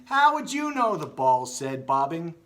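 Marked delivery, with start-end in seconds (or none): 0.1-0.9 high, loud indignant question; squeaky indignant comeback; bickering retort. Acted. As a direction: scoff at the bats. Balls bickering with the bats.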